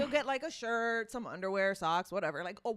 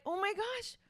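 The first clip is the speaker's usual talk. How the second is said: excited; 10 semitones higher, faster than usual. Retold or imitated. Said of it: "So I'm like".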